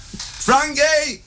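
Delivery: high shout of a name across the house; mum calling out. Retold or imitated. Mum calls her husband to the phone, high shout. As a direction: call the husband from the other room. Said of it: "she shouted for me father".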